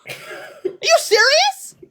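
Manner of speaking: high, slow are you serious; incredulous high drawn-out. Real, not acted. Streamer at chat, drawn-out high disbelief.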